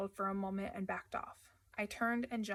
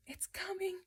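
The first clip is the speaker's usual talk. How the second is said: breathless scared whisper; frightened moan; 10 semitones higher than usual. Acted. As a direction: whisper it, terrified. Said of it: "he moaned".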